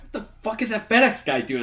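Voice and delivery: high, incredulous question; retold bafflement. Spotting a FedEx guy at the work window, baffled.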